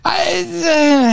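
a startled outburst; a sudden cry breaking into speech; sudden yelp. Real, not acted. Sudden cry mid-sentence.

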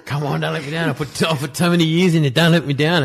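high-pitched mock pleading; comic impression of begging your own legs; pleading with his legs. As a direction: urge them on in a high, desperate put-on voice. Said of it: "Talking to his legs".

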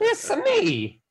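high, slow cartoon Italian voice; squeaky Italian plumber impression; Mario voice. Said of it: "like Mario from".

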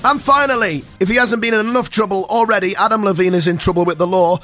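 radio headline read with mock importance; mock news bulletin. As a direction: announce the final showbiz story.

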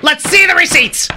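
high, loud hammed-up demand; mock TV judge. Judge interrupting the litigant, high mock shout. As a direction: shout it like a parody court-show judge.

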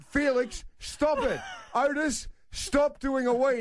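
a laughing half-panicked command; alarmed dad. Retold or imitated.

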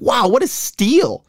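high, slow mock wonder; sarcastic amazement. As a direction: gush over it, dripping sarcasm. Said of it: "I was like".

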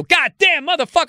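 sudden high, rushed curse; mock pained cursing. Language learner stubbing a toe, mock outburst of swearing. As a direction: burst out as if you stubbed a toe.

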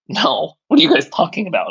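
imitated dismissive rejection, pitched high; incredulous refusal. Skeptical investor to three inexperienced founders, incredulous refusal. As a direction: turn them down in high-pitched disbelief.

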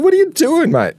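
very high-pitched imitated challenge; retold astonished question; mock incredulous. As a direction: squeal it in amused disbelief. Retold challenge to mate caught hiding things.

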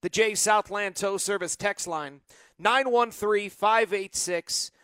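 normal talking voice

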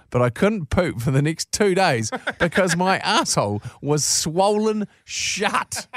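slow, cracking up mid-sentence; barely holding in laughter; laughing through words. Real, not acted. Laughs while reading the punchline.

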